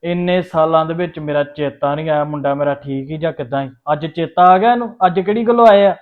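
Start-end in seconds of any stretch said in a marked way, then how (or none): 3.9-6.0 louder, higher bitter questions; rising resentful rant at the father; bitter outburst. Acted.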